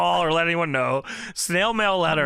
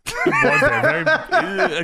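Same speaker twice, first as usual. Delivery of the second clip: laughing; 13 semitones higher, 5 dB louder, slower than usual. Real, not acted. Mocking Horace Hogan's look.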